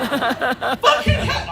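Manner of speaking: high, drawn-out cry, acted; screaming. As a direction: let out a slow, stretched mock scream. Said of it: "I was screaming".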